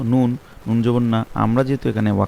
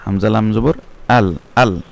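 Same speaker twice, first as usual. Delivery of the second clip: fast, clipped আল; quick pronunciation; 3 dB louder than usual. Shown as a demonstration. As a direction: say আল quickly without lingering. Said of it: "তাড়াতাড়ি উচ্চারণ করতে হবে". (আল তাড়াতাড়ি)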